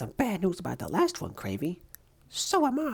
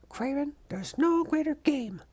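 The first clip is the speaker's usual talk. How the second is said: ordinary delivery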